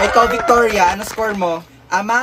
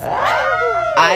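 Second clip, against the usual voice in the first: stung cry. Acted. Hurt Brenda cries out.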